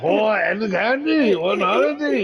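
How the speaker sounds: high, slow mock Japanese accent; hammy Japanese accent voice. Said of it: "my Japanese accent".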